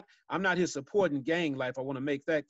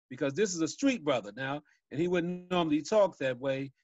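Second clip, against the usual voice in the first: ordinary talk, nothing marked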